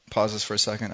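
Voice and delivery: ordinary delivery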